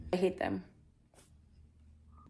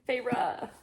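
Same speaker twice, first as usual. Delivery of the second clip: ordinary delivery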